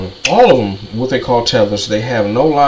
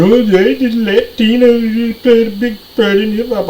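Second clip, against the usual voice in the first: higher mock voice of the rapper; 10 semitones higher, 3 dB louder than usual. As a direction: sneer as you parrot his claim, pitched up. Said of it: "He getting on".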